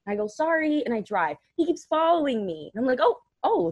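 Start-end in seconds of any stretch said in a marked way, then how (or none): 0.3-0.8 high-pitched, tossed-off apology; breezy apology. Retold or imitated. Acted apology to the driver. 3.0-3.7 high, louder exclamations of alarm; mock alarm. Acted alarm at being followed.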